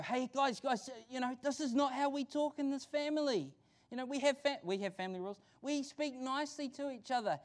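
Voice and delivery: mock parental telling-off. Parent steps in on fighting kids, mock reproving.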